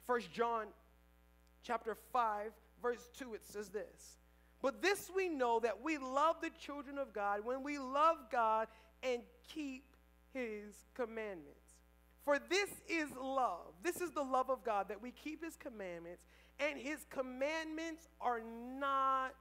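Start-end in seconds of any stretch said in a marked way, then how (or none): none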